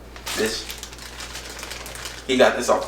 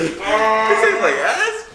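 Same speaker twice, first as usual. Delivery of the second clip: laughing; 10 semitones higher, much slower than usual. Real, not acted. Tasting candy, laughs mid-line.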